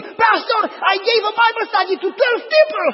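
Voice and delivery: high, loud, overjoyed call; excited shout. Retold or imitated. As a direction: shout the news to the pastor. Retold joyful phone call.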